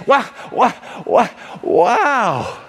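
stunned, repeated gasps of wonder; awestruck. Acted. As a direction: marvel as if seeing for the first time. Acting healed man seeing first time.

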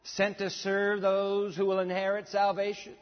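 loud, slow, declaimed verse; scripture reading. Acted. Preacher reads a verse to the congregation, loud proclamation. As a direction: proclaim it firmly, word by word.

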